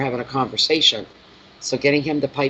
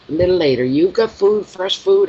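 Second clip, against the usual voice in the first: higher, louder mock scolding; exasperated; 5 semitones higher, 5 dB louder than usual. Real, not acted. Owner scolding the begging cat, higher and louder.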